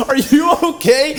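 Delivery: very high-pitched concerned question; imitated worried seatmate. Woman next seat asks window-puncher, imitated worry. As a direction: squeak the question in mock alarm.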